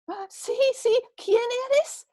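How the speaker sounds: man hanging from a branch, frightened; anxious, high voice. Acted. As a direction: ask nervously who is there. Jack pleading, high voice.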